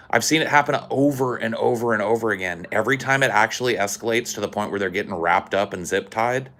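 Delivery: ordinary delivery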